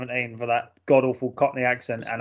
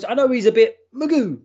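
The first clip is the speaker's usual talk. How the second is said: high, louder film-line imitation; quoted ex-wife; 9 semitones higher, 5 dB louder than usual. Explaining where the quote comes from, imitated ex-wife. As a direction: pitch it up as the ex-wife. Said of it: "when his ex-wife goes".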